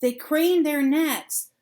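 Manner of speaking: ordinary delivery, nothing marked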